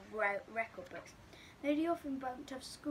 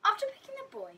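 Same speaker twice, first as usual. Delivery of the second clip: exclaimed; 8 dB louder, faster than usual. Acted. Dramatic story emphasis.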